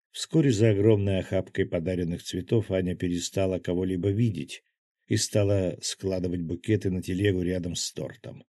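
usual speaking voice

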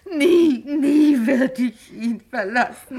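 loud, high wailing through tears; tipsy wife sobbing her loyalty aloud; hysterical sobbing. Acted. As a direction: wail the vow between sobs. Hysterical weeping vow.

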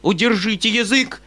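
high, loud command; uncle shouting. A narrator's reading. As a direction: cut her off sharply. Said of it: "вскричал дядя".

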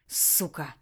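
low, husky breath of a curse; hoarsely exhaled curse. Acted. Beaten dictator cursing his rival, hoarse exhale. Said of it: "хрипло выдохнул Толян".

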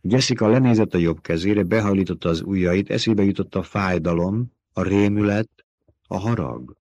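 ordinary delivery, nothing marked

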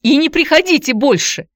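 high, loud, distraught cries; shouting. A narrator's reading. Aunt banishes visitor and pleads with raging nephew, shouting. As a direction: keep crying out over a struggle. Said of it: "кричала она мне".

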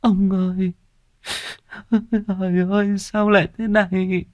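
high, tearful lament; mother weeping for her husband. Acted. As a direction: cry out to the missing husband. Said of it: "rưng rưng nước mắt".

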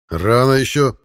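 loud, firm check; cool their cheering firmly; firm rebuke. Acted. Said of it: "ответил Хаук".

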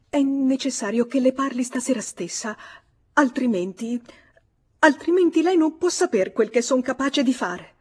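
high, loud, urgent pleading; agitated woman at the end of her patience; desperate threat. Acted. Spurned lover threatens him.

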